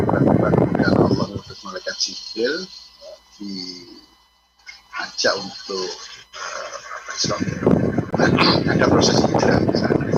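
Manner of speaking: blown-out windy mic sound; into a fan. Real, not acted. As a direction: voice buried in fan noise. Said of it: "ada suara kipas angin yang masuk".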